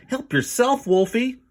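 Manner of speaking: high, loud, nervous cry; panicked offer. Acted. Kip throwing his bones down in panic, high and loud. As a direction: throw it out in a hurry.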